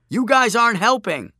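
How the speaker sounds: high, loud exasperated yell; shouting back. A narrator's reading. Narrator shouting back at Joshua's unhelpful admirers, loud yell. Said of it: "I shouted back at them".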